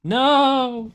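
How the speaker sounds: sudden high shout. Acted. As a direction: yell it in protest. Outburst about ads.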